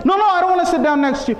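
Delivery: high, fast, flustered acted refusal; nervous refusal. Warned messenger refusing Imam's seat, nervously. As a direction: refuse in a hurry, on edge.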